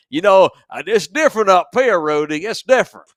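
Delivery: hammed-up hillbilly accent. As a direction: play a rowdy Southern good ol' boy. Mock Southern drawl voice, loud.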